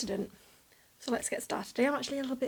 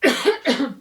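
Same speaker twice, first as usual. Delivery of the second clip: loud cough then a groaned word; real cough from a rough throat; 4 semitones higher, 11 dB louder than usual. Real, not acted. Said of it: "I am so sorry about my voice".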